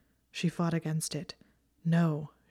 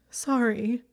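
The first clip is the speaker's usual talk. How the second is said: higher, louder sobbing apology; sobbed sorry; 6 semitones higher, 4 dB louder than usual. A narrator's reading. Hermione crying, apologizing to Draco through sobs. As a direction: choke the word out through tears. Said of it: "she sobbed".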